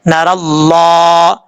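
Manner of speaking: اللہ drawn out slowly with a full, heavy lam; full heavy Allah. Shown as a demonstration. Teacher models heavy lam in Allah, slow. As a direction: let اللہ sound thick and round. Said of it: "یعنی موٹا پڑھا جاتا ہے".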